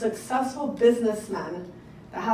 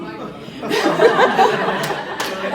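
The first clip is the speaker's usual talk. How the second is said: loud high burst of breathy laughter; real delighted laugh; big laugh; 10 semitones higher, 7 dB louder than usual. Real, not acted. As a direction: let the laugh break out. Laughing after telling her boss.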